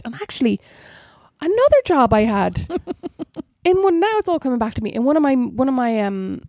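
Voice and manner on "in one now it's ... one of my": ordinary talk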